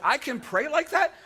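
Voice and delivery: wait, this is allowed; surprised question. Pretend.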